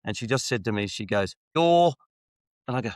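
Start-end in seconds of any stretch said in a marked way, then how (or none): 1.5-1.9 loud, high brayed greeting; donkey-like bray. Retold or imitated. Receptionist turning from a drawer to greet him, brayed loud like a donkey. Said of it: "like a donkey".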